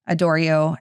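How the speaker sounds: ordinary talk, nothing marked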